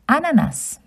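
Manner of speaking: ananas as a-na-nas, even stress; French pronunciation. Shown as a demonstration. Teaching children ananas. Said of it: "Pineapple in French is".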